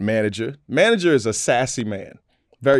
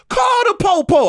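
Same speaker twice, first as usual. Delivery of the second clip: mock girly voice, much higher and louder; girlfriend mouthing off; 5 dB louder than usual. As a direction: squeal it like a fearless girlfriend. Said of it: "Here she goes".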